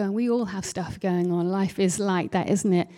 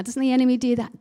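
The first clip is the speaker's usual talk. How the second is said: ordinary talk, nothing marked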